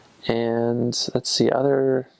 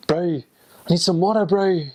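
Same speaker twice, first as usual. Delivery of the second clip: higher-pitched New Zealand accent; Kiwi accent; 7 semitones higher than usual. Shown as a demonstration. Returned missionary showing off favorite accent, New Zealand Kiwi. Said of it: "it's like Australian, but more like".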